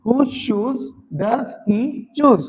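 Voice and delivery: very slow, clear words; teacher reading together with students, slowly; slow drill. Shown as a demonstration. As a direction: say it along with the class slowly. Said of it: "आप मेरे साथ एक बार practice करेंगे".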